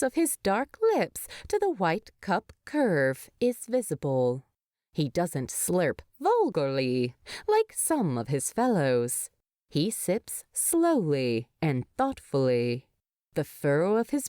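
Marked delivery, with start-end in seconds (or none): none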